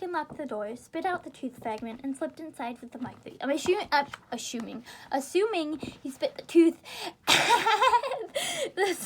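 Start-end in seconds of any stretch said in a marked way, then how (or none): 5.1-9.0 words broken by laughter, slowed; laughing. Real, not acted. Reader amused by the tooth spitting, laughing. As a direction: giggle through it.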